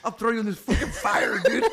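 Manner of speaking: laughing mock threat. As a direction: joke menace while laughing.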